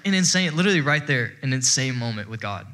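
usual speaking voice

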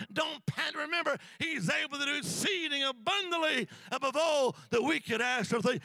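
loud, high preacher's cry; full-force preaching. Acted. Preacher rousing the congregation, loud and high.

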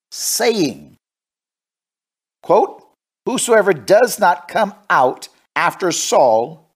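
slow, declaimed Bible reading; dramatic scripture reading. Acted. Preacher reads King Saul's threat to Israel, dramatic scripture reading. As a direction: read it out weightily, drawing out phrases.

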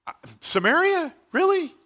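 high-pitched mock disbelief; doubtful disciples. Disciples balking at being sent to Samaria, incredulous. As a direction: query it as if you misheard, pitch leaping up. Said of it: "I think the disciples went".

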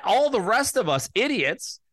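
usual speaking voice

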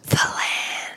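airy whisper of THE LAND; whispered tagline echo. Pretend. Co-host echoing the sponsor tagline, whispered. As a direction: whisper it like a mock ASMR ad.

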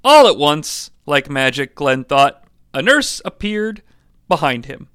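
ordinary delivery

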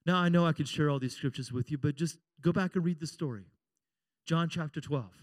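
ordinary talk

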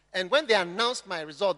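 ordinary talk, nothing marked

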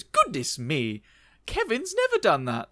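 put-on surprised old lady, rising high. Margaret amazed at her dog's backflip, high old-lady voice. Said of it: "Margaret turns around and goes".

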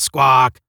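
sudden loud squawk; headphone squawk. A narrator's reading. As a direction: blurt it like interrupting radio noise. Headphone noise interrupts the speech.

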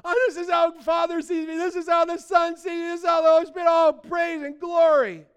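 high, shouted, breathless-sounding rush of praise; so excited. Acted. Preacher acts out Paul praising God from prison, wildly excited shout. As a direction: burst out loud, overflowing with excitement. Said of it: "he's so excited. He goes".

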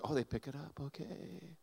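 very soft, mild little voice; uncomplaining helper. Retold or imitated. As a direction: imitate a meek helper, trailing away almost to nothing. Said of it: "The serving people actually does".

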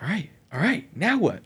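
high-pitched, acted eager readiness; happy eagerness. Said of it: "I'm happy now".

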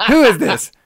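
high, insistent mock demand; acting out an urgent phone query; demanding question. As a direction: pitch it way up, demanding. Repeats the sergeant's demand.

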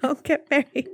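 laughing as she repeats the joke warning. Real, not acted. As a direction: break into a laugh on the line. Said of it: "I'm gonna give you advice".

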